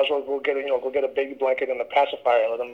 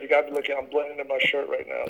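normal talking voice

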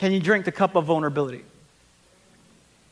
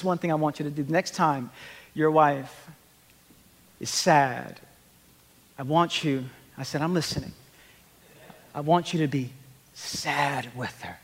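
lower, soft, spaced-out counsel; therapist's advice; 4 semitones lower, 6 dB quieter than usual. Retold or imitated. Therapist advising a husband, soft and deliberate. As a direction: deliver it softly and low, with weighty pauses.

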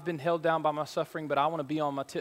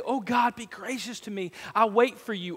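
prayed aloud; 4 semitones higher than usual. Shown as a demonstration. Pastor urging the church to pray aloud, lifted voice. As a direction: say it boldly to God. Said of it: "what if you would this morning just say".

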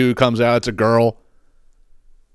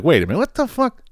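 higher, startled double take; baffled; 6 semitones higher than usual. Retold or imitated. Comedian realizing a trend passed him by, baffled. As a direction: catch yourself in disbelief. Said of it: "and I'm like".

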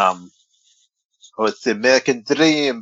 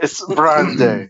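slow, drawn-out, higher and louder leer on Brandy; creepy leering voice; 5 semitones higher, 6 dB louder, much slower than usual. Pretend. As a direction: drag out "It's Brandy" like a creep. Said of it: "What happened there with your voice?".

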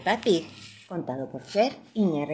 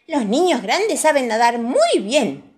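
put-on high, loud, slow child's voice; drawn-out, high-pitched know-it-all child; boasting little girl; 7 semitones higher, 7 dB louder than usual. A narrator's reading. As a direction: boast like a proud big kid.

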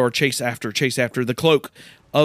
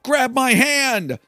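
higher, slow urgent cry; hero's call; 10 semitones higher, slower than usual. Retold or imitated. Eric rescuing Kylie on horseback, urgent cry. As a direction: reach out and call for her. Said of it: "Eric's like".

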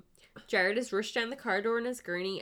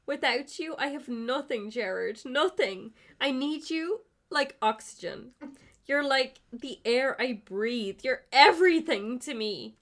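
melodramatic confession, higher and louder as it builds; hammed-up love confession; 6 semitones higher, 5 dB louder than usual. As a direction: pour out your heart, overacting, to the sick boyfriend. Player voicing an emotional confession.

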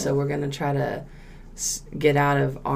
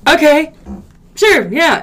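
high, loud mock agreement; retold concession; 11 semitones higher, 12 dB louder than usual. Retelling the YouTube argument, high loud concession. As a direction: give in loudly, pitched way up. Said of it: "And I was like".

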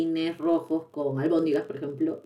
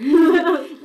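bright burst of laughter, louder and high; laughing; 10 semitones higher, 7 dB louder than usual. Real, not acted. Laughing at her own punchline to her co-host, loud. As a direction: laugh it off loudly.

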